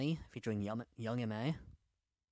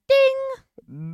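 bell ding; 15 dB louder than usual. Pretend. Imitating the notification bell.

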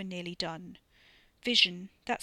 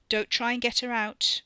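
loud, higher-pitched word of warning; 4 semitones higher, 7 dB louder than usual. A narrator's reading. Ricky cautions against getting her out, warning. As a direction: warn them off with a raised voice. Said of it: "Ricky warned".

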